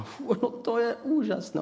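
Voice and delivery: high, breathy-free gasp of wonder; mock amazement. Family amazed at new house. (předstíraný úžas, vysokým hlasem)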